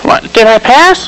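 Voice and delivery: startled question. Acted. Waking from a nap, startled.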